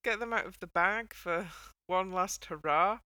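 normal talking voice

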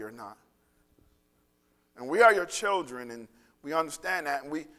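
low, earnest pastoral prayer voice. Acted. Pastor praying for the congregation, earnest and low. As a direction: pray over the congregation, unhurried.

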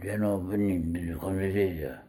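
mock-Swedish mush; bad Swedish accent. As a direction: garble it in a hopeless fake Swedish accent. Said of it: "I don't think that's very Swedish".

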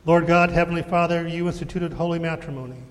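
usual speaking voice

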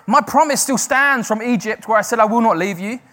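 loud, high proclaiming voice; screaming God's promise. Acted. Preacher voicing God's promise to the congregation, loud and high. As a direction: cry it out to the crowd. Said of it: "it's like he's screaming it to us today".

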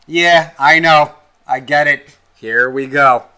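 loud, drawn-out concession; loud mock resignation. Podcaster answering expected repercussions, loud mock resignation. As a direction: concede it loudly, one short phrase at a time. Bracing for listeners' objections.

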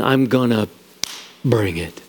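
low, halting threat; ominous promise. Acted. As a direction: drop low and pause before the punch. Paraphrasing God's threat of judgment.

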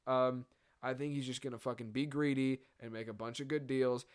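ordinary talk, nothing marked

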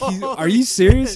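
high, loud, slowed-down astonished question; retold incredulity. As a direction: replay your stunned reaction, stretching the words. Retold disbelief at the news.

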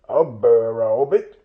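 loud, puzzled troll question; a bit startled. A narrator's reading. Trolls repeating Bilbo's odd word, a bit startled. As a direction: echo it in mild troll bafflement. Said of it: "said they, a bit startled".

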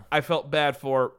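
ordinary delivery

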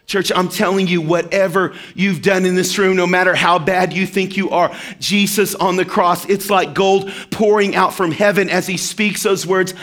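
ordinary talk

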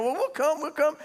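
high, insistent mock pleading; eager, repeated high-pitched plea; pleading disciples. Disciples whining to tag along.

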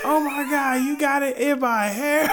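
put-on high, slow, squealing girl's voice; mock shrieking girl. Cousin's girl shrieking about her hair. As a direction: shriek it like a startled girlfriend.

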